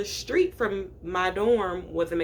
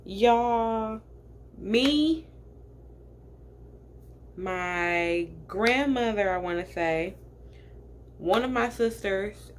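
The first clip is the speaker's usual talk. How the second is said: ordinary talk, nothing marked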